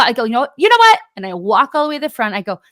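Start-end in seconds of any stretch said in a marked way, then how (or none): 0.2-1.0 much higher and louder burst; exasperated outburst. Retold or imitated. Retelling how she snapped back at the stranger, loudly. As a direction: throw it back at him, fed up. Escalating retort in retold argument.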